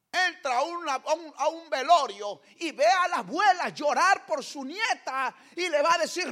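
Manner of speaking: loud, high preaching voice; fiery preaching. Acted. Preacher stirring the congregation, loud and high. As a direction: drive it home from the pulpit.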